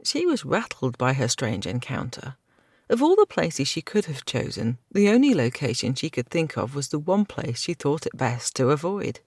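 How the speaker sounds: usual speaking voice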